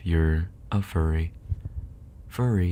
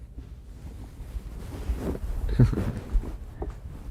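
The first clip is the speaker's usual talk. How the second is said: laughing helplessly, almost silent; breathy unvoiced laughter; 5 semitones higher, 5 dB quieter than usual. Real, not acted. Friend cracking up at the dog noises, silent laugh.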